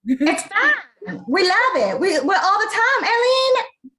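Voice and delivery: high, loud Southern twang; Southern accent. Pretend. Castmate showing off the show's accent to the interviewer, loud Southern twang. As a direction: whoop it up with an exaggerated Southern twang. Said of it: "we all have our little twangs".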